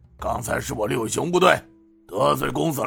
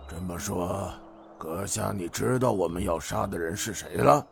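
much softer, menacing question; sinister low tone; 3 semitones lower, 10 dB quieter than usual. Acted. Eldest bandit probing the hero, quiet and sinister. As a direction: ask it cold and quiet. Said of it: "阴声问道".

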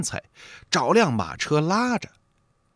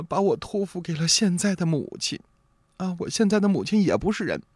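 put-on choked-up young wife; 8 semitones higher than usual. A narrator's reading. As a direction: confess tearfully in a young woman's voice, understated. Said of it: "这才哽咽着说".

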